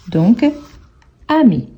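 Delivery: normal talking voice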